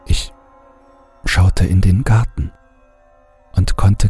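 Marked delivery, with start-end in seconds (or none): none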